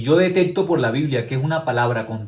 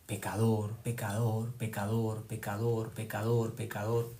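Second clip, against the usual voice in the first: softer, insistent accusing murmur; voice of condemnation; 3 semitones lower, 9 dB quieter than usual. Retold or imitated. Preacher voicing Satan accusing Judas, soft and relentless. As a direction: repeat it like a voice inside his head. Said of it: "escuchó la voz de la condenación".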